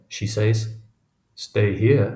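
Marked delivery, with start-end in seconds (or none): 1.3-2.1 careful, questioning echo; mildly guarded, measured query; careful repeat. A narrator's reading. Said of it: "he repeats carefully".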